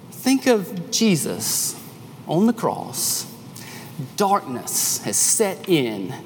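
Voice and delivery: ordinary talk, nothing marked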